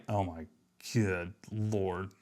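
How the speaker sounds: ordinary talk, nothing marked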